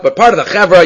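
ordinary delivery

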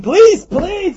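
high, loud call for police; hammed-up shout, police police; mock scream. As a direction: yell police down the street. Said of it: "you can scream".

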